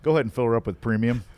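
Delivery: loud, quick full-service order; old-time gas attendant. Retold or imitated. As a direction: call it out like a pump jockey. Retro gas station attendant line.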